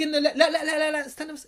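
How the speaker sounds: high, insistent string of objections; protesting interruption. Acted. Listener cutting off someone's religious advice, protesting. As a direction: cut in hurriedly to stop the lecture. Acted listener cuts off the admonisher.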